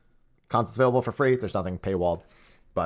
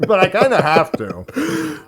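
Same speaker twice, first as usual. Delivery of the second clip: slow words round a bite of food; chewing, higher and slower; mouth full; 10 semitones higher, 6 dB louder, slower than usual. Real, not acted. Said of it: "But you are".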